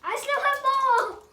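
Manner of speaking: high, loud thrilled cry; loud, high delighted exclamation; excited shout. Real, not acted. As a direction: burst out with excitement. Excited announcement of more toys.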